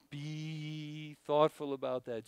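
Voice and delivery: slow, measured advice to his sons; slow fatherly advice. Retold or imitated. Dad slowly advising sons to stay calm. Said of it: "And I'm like".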